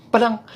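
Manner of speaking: "Padang" high and short; final-word stress. Shown as a demonstration. Showing the Padang seller's ending, "Padang" short and stressed. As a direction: hit "Padang" as the tail of the call. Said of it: "Ada penekanan di belakang".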